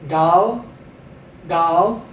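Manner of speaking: gal, ayin voiced as a gentle G; slight G for ayin. Shown as a demonstration. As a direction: start gal with a light G sound. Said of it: "We're giving the ayin a slight G pronunciation".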